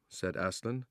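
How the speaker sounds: usual speaking voice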